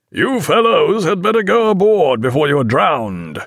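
high, carrying shout; calling to distant boats. A narrator's reading. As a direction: direct your voice to the boats astern. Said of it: "Jack directed his voice towards".

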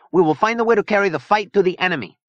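grave resolve, pitched high. A narrator's reading. Zona vowing to fight the enemy, grave. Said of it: "Zona Rosa said gravely".

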